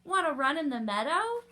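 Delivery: high, loud storybook sheep question; sheep character. A narrator's reading. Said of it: "bleated the sheep".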